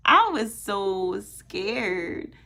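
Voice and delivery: usual speaking voice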